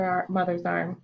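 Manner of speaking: ordinary delivery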